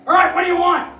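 high, very loud demand at the door; acted hostile shout. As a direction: imitate an annoyed householder yelling at a caller. Hostile householder answers the door.

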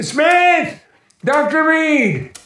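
high, loud calls into the house; calling out. Acted. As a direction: shout their names to find them. Calling out for missing men.

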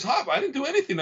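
mock innocent D C. D C denying everything to Hop, high whiny innocence. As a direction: pitch way up, play innocent. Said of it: "He goes".

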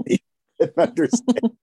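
laughing. Real, not acted. Laughing confusion retold.

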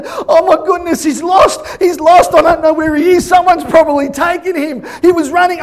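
high, loud, frantic rush of words; a mother sure her son is lost; imitating frantic high shouting; panicked shout. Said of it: "she goes".

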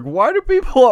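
ordinary talk

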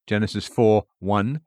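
ordinary delivery

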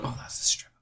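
whispered aside. Retold or imitated. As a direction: breathe it out dismissively. Quoting dismissive audience.

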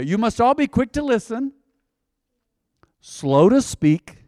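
slow to speak said very slowly; slow to speak drawn out. Shown as a demonstration. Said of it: "That doesn't mean you gotta speak slowly".

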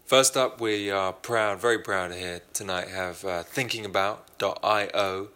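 sponsor read. Acted. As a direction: sell it like a playful announcer. Host reads sponsor ad.

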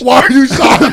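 high, loud question bursting into laughter; laughing shout. Real, not acted. Host asking friend why he's shocked, laughing shout. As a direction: shout it in amused disbelief. Exasperated, amused challenge to friend.

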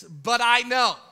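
steady faith. Acted. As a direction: hold on to belief, slowly. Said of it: "she says this".

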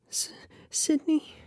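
high, airy, stammered question; breathless whisper. Acted. Breathless question mid-climax.

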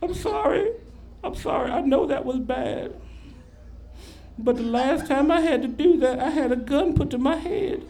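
high, mock-crying woman's voice; imitated tearful woman. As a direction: play a weepy, high-pitched apology. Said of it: "and she's crying".

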